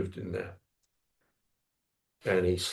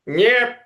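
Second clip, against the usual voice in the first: a sudden high, loud nasal "Nyep" yelp; street vendor's call; 13 semitones higher, 8 dB louder than usual. Retold or imitated. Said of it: "The call you get is usually like".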